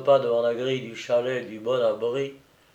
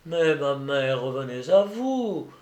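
ordinary delivery

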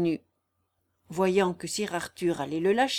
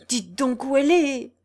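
raised, louder and higher demand; urgent cry; 6 semitones higher, 7 dB louder than usual. A narrator's reading. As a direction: cry out impatiently for an answer. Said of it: "s'écria Guillaume".

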